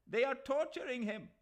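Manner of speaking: high, loud accusation; alarmed shout. A narrator's reading. Mahmud Ali alarming the crowd, loud shout. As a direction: shout the outrage.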